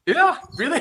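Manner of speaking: "Yeah, really?" lifted high like a question; a high questioning "really?", mocking own lilt. Telling the gym banter, she mimics her rising question tune. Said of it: "You always sound like you're asking a question".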